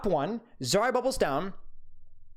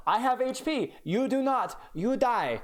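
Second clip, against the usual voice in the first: higher, mock Russian accent that fades out; bad Russian accent; 7 semitones higher, slower than usual. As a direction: put on a failed Russian accent, half-hearted. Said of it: "It's supposed to be Russian".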